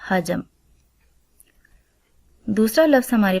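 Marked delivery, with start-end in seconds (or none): 0.1-0.4 حجم said clearly as ha-jam, short a on ح and ج, م closed with no vowel; careful pronunciation. Shown as a demonstration. Teacher showing students how حجم is said: ha-jam, clear and careful. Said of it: "اس کا تلفظ کچھ اس طرح سے ہوگا".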